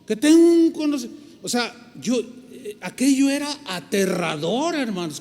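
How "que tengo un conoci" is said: imitating a haughty theologian, higher and louder; puffed-up scholar scoffing; arrogant quote. Theologian scoffing at the young pastor, haughty and louder. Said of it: "Como diciendo".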